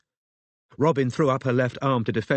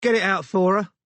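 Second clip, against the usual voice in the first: mother calling out; 9 semitones higher, 5 dB louder than usual. Acted. Mother ordering daughter.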